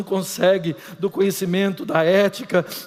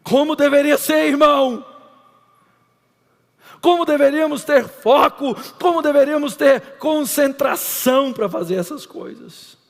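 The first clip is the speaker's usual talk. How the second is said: higher, louder rhetorical questions; preaching; 9 semitones higher, 6 dB louder than usual. Acted. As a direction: challenge the brothers in the pews. Pergunta retórica à igreja. (pregação com perguntas retóricas)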